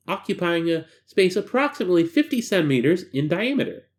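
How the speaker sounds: usual speaking voice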